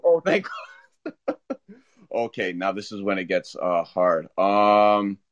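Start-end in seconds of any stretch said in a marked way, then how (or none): 0.0-1.6 slow, high, laughing with relief; scared then relieved. Real, not acted. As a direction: breathe it out through a laugh. Relieved to get one more pick.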